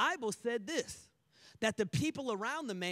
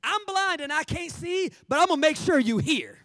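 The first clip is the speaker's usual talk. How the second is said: raised, pressing plea; louder and higher, determined; insistent blind beggar; 3 semitones higher, 7 dB louder than usual. Acted. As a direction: call out to make sure you're heard. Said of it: "he's like".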